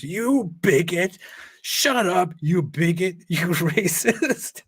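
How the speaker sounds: high, drawn-out parody of an outraged yell; Cenk's mock outburst. Host tries out a soundboard clip of Cenk, mock yelling. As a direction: imitate a ranting insult, amused, last word stretched. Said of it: "so cringeworthy when Cenk yells".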